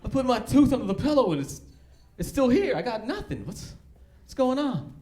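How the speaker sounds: put-on a disappointed child asking about the tooth; sad little boy. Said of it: "he just came out all sad".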